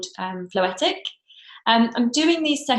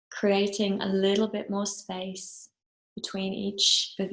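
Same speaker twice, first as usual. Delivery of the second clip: guided meditation. Acted. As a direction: ease them gently into stillness, phrase by unhurried phrase.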